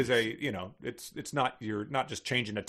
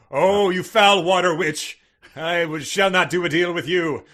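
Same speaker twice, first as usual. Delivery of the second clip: heroic shout; 7 semitones higher, 12 dB louder than usual. Acted. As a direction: declaim it like a stage hero. Mock hero confronts witch.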